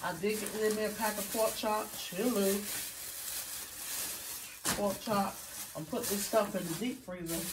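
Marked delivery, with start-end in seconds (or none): none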